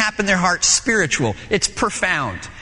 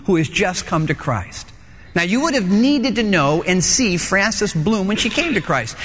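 ordinary delivery

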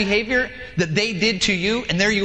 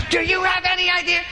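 outraged driver yelling across lanes; road rage shout; 9 semitones higher than usual. Acted. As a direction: shout at them through the window. Enraged driver confronts other car.

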